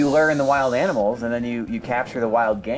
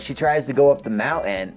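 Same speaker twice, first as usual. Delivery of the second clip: ordinary talk, nothing marked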